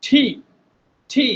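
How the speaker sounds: ঠি with a breathy puff on the T; aspirated T. Shown as a demonstration. Teacher models aspirated ঠি for students. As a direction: hit ঠি with air. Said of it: "এটাকে কি বলবো".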